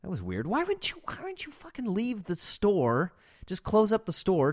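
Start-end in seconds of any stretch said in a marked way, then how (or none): 0.5-1.0 a sudden high, exasperated "why"; exasperated disbelief. Real, not acted. Exasperated about the waitress. 1.0-4.5 hissed, incredulous whisper; exasperated whisper. Real, not acted. Exasperated about the waitress.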